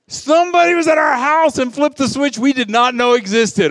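high, shouted indignation; loud incredulous punchline; comic outrage. Real, not acted. Comic outrage at hidden switch.

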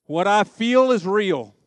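loud, slow, emphatic declaration; the emotions insisting. Acted. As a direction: declare it slowly as absolute fact. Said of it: "Over here".

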